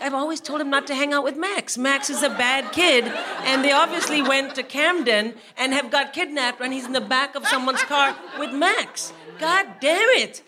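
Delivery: high, loud comic indignation; exasperated parent rant. Retold or imitated. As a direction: rant in mock-panicked outrage. Re-enacted panicked rant about son's friend.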